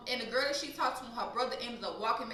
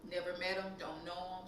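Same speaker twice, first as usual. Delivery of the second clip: ordinary talk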